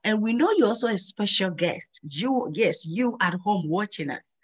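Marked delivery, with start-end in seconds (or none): none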